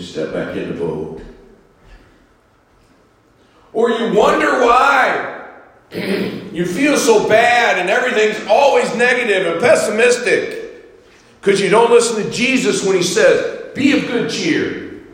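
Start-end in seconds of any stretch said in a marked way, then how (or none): 3.7-15.0 high, loud preacher's cry; full-force preaching. Acted. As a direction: preach it to the back row.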